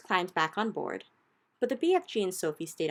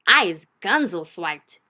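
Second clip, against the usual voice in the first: slow, much louder giant shout; 12 dB louder, slower than usual. Acted. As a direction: shout it like a giant tied up in chains. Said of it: "shouted the meat dripper".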